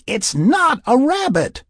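very high, slow protest; high-pitched, emphatic denial; heated refusal. A narrator's reading. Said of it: "she refused".

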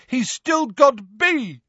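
very high, drawn-out yell; shout from above. A narrator's reading. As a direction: call it down defiantly, high and slow. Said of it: "shouted a voice from above them".

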